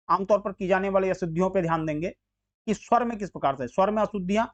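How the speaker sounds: ordinary talk, nothing marked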